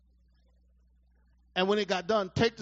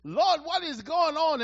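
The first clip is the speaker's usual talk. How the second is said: crying out to God; 9 semitones higher, 9 dB louder, much slower than usual. Retold or imitated. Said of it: "I was like".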